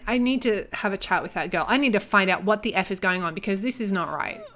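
normal talking voice

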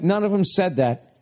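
ordinary talk

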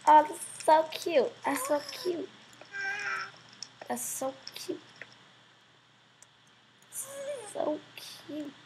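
soft, high adoring coo. Real, not acted. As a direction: fade into a tiny high coo. Cooing over a little demon.

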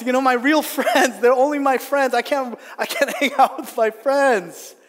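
hammed-up moan about missing out; mock whining. Churchgoer moans about a media fast, mock whining.

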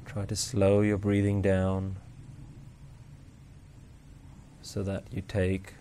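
slow, soft, even meditation voice; guided meditation. Acted. Meditation teacher guiding listeners to notice each breath, calm and unhurried. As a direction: guide them gently through the breath with long pauses.

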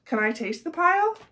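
high eager pig asking; a hungry pig begging a taste. A narrator's reading. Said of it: "asked Pig".

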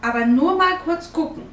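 put-on high, loud condition from a child; called out. A narrator's reading. Girl setting her condition to mother, called out high. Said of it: "ruft sie".

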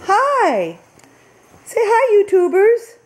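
high, bright squeal of a greeting; big excited hi to the camera; excited hello. Real, not acted. Cheery greeting to viewers.

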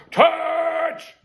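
high, ringing call of a preacher; preacher's cry. Acted. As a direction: fling the word out like a revival preacher. Self-made preacher calls out blessing.

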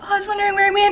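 high-pitched imitation of a dog owner; dog owner's voice. Voicing the owner's relieved reply.

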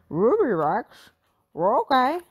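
slow tempted dog speech; Scooby-Doo voice. A narrator's reading. Scooby agreeing for snacks in dog speech. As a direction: perk up at the treat. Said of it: "Velma asked".

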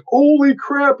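loud, high drawn-out exclamation; mock shock. Rescuers finding their friend's body, loud mock shock. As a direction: blurt it in hammed-up astonishment.

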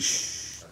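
hushing shh. Retold or imitated. Grandpa hushing the boy.

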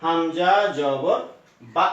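হামজা জবর বা drawn out slowly; slow, deliberate sounding out for the learner; slow spelling out. Shown as a demonstration. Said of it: "তাহলে বা".

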